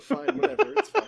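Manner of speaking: high-pitched mock pout; mock-hurt squeak. Player conceding to teasing friends, mock-hurt high voice. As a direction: squeak it out, pretending to be wounded.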